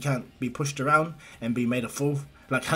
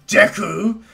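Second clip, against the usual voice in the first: loud, raised-pitch acted shout; Bakugo's yell; 7 semitones higher, 11 dB louder than usual. Hothead calls out his friend's nickname, yelling. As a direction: burst out with the nickname, loud and high.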